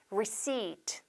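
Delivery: receipt said softly with a long ee, silent P; long vowel. Shown as a demonstration. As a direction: stretch "receipt" into re-SEEET, no P. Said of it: "장음으로". (receipt를 '리시-트'로 부드럽게 장음 발음)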